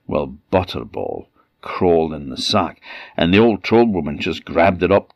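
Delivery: ordinary delivery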